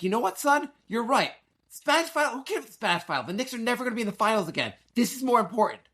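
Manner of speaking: high-pitched, worked-up retold argument; worked-up declaring. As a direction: retell it as a fired-up dad taking his son's side. Retelling defiant pep talk to son.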